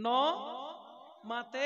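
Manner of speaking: নমাতেকা said very slowly, syllable by syllable; slow demo. Shown as a demonstration. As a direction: draw out নমাতেকা slowly for the audience to repeat. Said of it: "বলেন না". (নমাতেকা ধীরে ধীরে উচ্চারণ)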